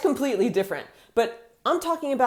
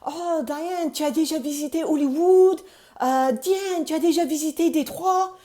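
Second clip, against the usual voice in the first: put-on higher-pitched French kids asking eagerly; excited French schoolchildren; 8 semitones higher than usual. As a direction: imitate French pupils quizzing their teacher. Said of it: "they would always ask me questions like".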